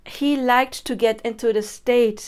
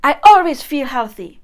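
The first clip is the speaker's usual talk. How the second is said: loud, higher, forceful hype; power incantation; 4 semitones higher, 12 dB louder than usual. Shown as a demonstration. Coach hyping herself with loud affirmations. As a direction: pump yourself up, power out. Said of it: "put your power into it".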